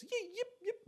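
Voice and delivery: very high yipping cries; squeaky, festive yip-yip; Mexican yips. Retold or imitated. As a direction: imitate a grito-style yip. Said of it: "one of those Mexican yips".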